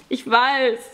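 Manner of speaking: put-on high, blubbering boy; tearful whining. A narrator's reading. Boy admits his shame to Pawel, tearful whining. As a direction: whimper it in shame. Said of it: "sagte er flennend".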